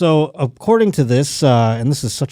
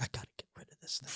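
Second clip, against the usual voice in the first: fast, hushed mock whisper; scheming whisper; 27 dB quieter, much faster than usual. Girl plotting against the rival goat, rapid whisper. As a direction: whisper it like a secret plot.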